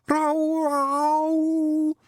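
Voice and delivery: high, loud mournful wail; mournful moan. A narrator's reading. Pixie poet moaning before reciting, high mournful wail. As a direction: wail it like an animal in pain. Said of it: "The moan rolled around the clearing, as mournful".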